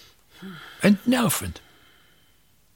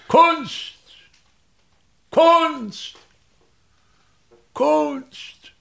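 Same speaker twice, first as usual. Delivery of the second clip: exclaimed outcry; 9 semitones higher, much slower than usual. Acted. Actor scorning the locals' hatred of art, high ringing outcry. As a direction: hurl the word out like an indignant tragedian.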